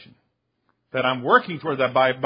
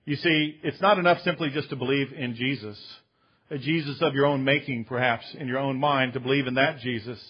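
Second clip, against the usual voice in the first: usual speaking voice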